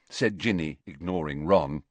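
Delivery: ordinary delivery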